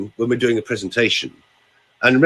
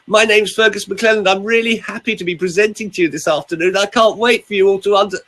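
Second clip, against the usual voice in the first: raised, strained presentation voice; nervous high presenter; 8 semitones higher than usual. Shown as a demonstration. Coach demoing a stressed presenter introducing himself, high. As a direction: open the talk in a tight, high voice. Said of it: "if I now speak to you like this".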